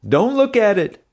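very high, loud sharp command; ordering. A narrator's reading. Bubba ordering the others away from the blast, loud high command. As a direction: bark the order at them. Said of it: "Bubba ordered".

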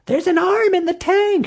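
shriek. A narrator's reading. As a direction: scream it out in terror. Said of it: "she shrieked".

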